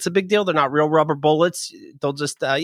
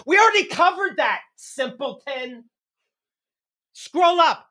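scornful put-down; 9 semitones higher than usual. Acted. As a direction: pitch jumps way up, then a slow, hushed simpleton. Ranting at sibling in argument.

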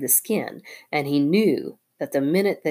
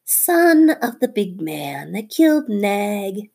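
slow, higher menacing hiss; hissing cobra; 4 semitones higher, 4 dB louder, slower than usual. Acted. Nagina hissing at Teddy's family, slow and menacing. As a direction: hiss the threat slowly. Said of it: "she hissed".

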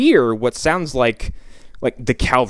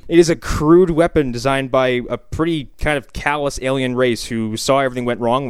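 ordinary talk, nothing marked

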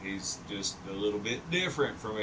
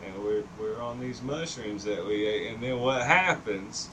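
usual speaking voice

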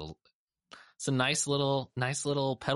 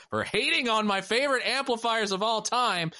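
high, booming wrestling promo trash talk; wrestling promo shout; 6 dB louder than usual. Pretend. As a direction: yell it like a ring announcer hyping a feud. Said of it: "my best scathing professional wrestling promo".

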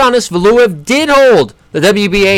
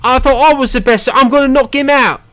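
high-pitched put-on British voice; boastful British accent, hammed up; mock British accent; 8 semitones higher than usual. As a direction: mimic a proud British boxer, pitched up. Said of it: "whole British pride things".

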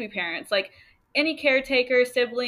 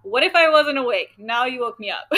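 louder, higher grumpy complaint; annoyed retort; 4 semitones higher, 7 dB louder than usual. Retold or imitated. Teen daughter grumbling at mom for waking her. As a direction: snap back half-joking at being woken. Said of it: "be really freaking annoyed".

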